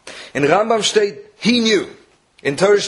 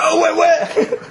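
very high, laughing mock eagerness; excited mock eagerness; much slower than usual. Husband answering wife about visitors who just left, hammed-up excitement. Eager reply to wife's news.

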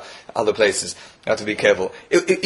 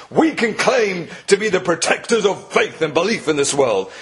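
retold true believer; 6 semitones higher than usual. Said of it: "He says, "You don't believe".

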